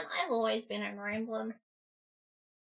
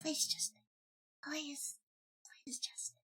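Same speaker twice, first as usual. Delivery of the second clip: fading plea; 3 semitones higher, 11 dB quieter than usual. Pretend. Pleading fades to nothing.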